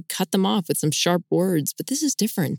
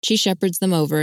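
plain reading voice

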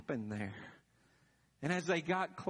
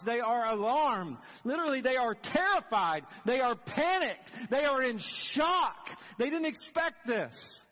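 louder and higher with each phrase, building; rising alarm; 5 semitones higher, 4 dB louder than usual. Acted. Preacher building the women's terror for the congregation, louder and higher. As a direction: pile on the panic, slowing at the end.

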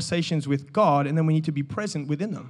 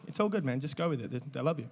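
soft aside to the volunteer; quiet reassurance; 5 semitones lower, 11 dB quieter than usual. Real, not acted. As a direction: murmur it just for him.

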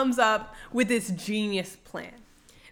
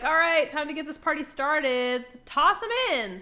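higher rallying call to the gang; lightly hammed-up party-starting call; rallying villains; 6 semitones higher than usual. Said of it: "they're like".

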